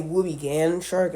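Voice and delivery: woobegone said with a flat 'gan' ending, WOO-bee-GAN; others' pronunciation. Retold or imitated. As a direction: say woobegone to rhyme its end with 'can'. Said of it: "I've heard people say".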